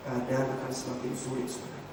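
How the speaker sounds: usual speaking voice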